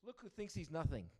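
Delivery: loud, high, scornful jab; sneering remark. Retold or imitated. Rabbi mocking the third rabbi's humility, loud sneer. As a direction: scoff at him with loud contempt. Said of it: "one looks at the other and goes and says".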